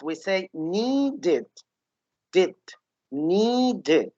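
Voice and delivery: needed said slowly, final did clearly separated; needed with extra syllable. Shown as a demonstration. Teacher modeling needed for students. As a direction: stretch needed so the did shows. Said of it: "So the word need, in the past".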